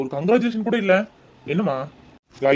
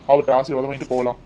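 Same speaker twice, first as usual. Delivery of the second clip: ordinary talk, nothing marked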